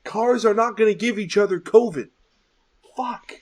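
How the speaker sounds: loud, high-pitched outburst; exasperated rant. Real, not acted. Host complains about spaced-out drive-thru cars, loudly exasperated. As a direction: protest it in heated exasperation.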